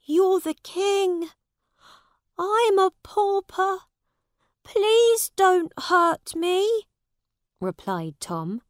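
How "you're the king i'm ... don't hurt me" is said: put-on much higher and louder, slow pleading boy; a terrified pauper begs the king; frightened Tom. A narrator's reading. Narrator voices frightened Tom.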